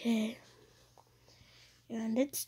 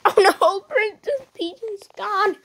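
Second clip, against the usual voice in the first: high, loud alarmed cry; high panicked voice; 11 semitones higher, 7 dB louder than usual. Acted.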